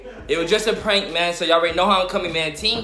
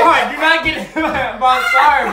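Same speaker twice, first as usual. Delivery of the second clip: prank reveal laughing; 5 semitones higher, 6 dB louder than usual. Real, not acted. Laughing while pleading.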